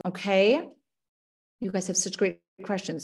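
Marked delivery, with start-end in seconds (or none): none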